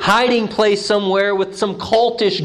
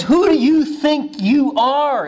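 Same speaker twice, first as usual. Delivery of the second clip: scornful who do you think you are; mock offended religious leaders; indignant challenge; 7 semitones higher, slower than usual. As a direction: sneer it, higher and slow. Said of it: "in other words".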